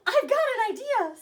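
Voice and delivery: high, loud mouse squeak; squeaky idea. Acted. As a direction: squeak it out with excitement. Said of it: "squeaked the Big Cheese".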